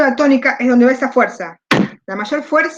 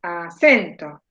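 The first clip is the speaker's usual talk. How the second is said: "Acento" slow and low, CEN standing out; deliberate "Acento" with a falling, stressed CEN; stress on middle syllable; 6 semitones lower, much slower than usual. Shown as a demonstration. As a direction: stretch "Acento", leaning on CEN. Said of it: "¿Cuál es la sílaba tónica?".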